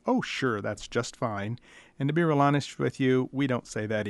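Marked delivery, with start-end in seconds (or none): 0.1-1.6 sarcastic manager. Retold or imitated. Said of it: "the manager of the grocery store is gonna say".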